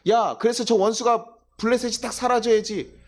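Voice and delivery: a mock vengeful cheer; vengeful crowd. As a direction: gloat over the enemy's fall. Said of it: "사람들이 얘기하는 것은".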